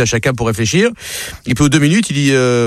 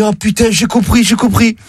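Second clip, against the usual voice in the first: excited, higher-pitched relief; 7 semitones higher, 3 dB louder than usual. Acted. Footballer who finally got the riddle, excited. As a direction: blurt it out, pitched up. Acted joke, player finally understands.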